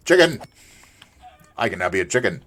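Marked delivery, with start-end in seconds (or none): 0.1-0.4 high, loud single shout; excited exclamation. Real, not acted. Player spotting a chicken, excited shout. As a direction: call it out, pitched up.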